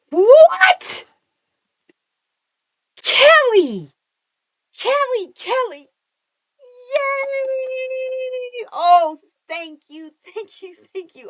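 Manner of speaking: high, squealing cries of joy; deafening excitement. Real, not acted. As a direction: shriek with delight at a friend's good news. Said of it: "I'm sorry if I'm making you guys deaf".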